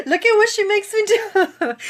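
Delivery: high, loud tearful outburst; crying and laughing. Real, not acted. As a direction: wail it half-laughing through happy tears. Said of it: "would be on here crying".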